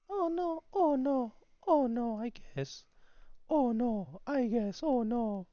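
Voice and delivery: very high, loud, squeaky voice; squeaky falsetto. Pretend. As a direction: yelp it in a cartoonish falsetto. Mock dismay in falsetto at a chart.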